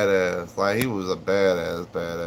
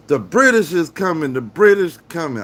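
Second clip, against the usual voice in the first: higher, louder, urgent warning call; mock alarm cry; 8 semitones higher, 6 dB louder than usual. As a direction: yell the alarm like a town crier. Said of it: "when they was like".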